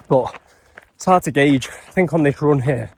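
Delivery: normal talking voice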